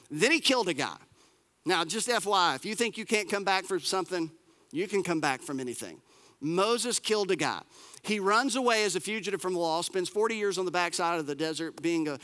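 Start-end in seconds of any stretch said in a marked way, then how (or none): none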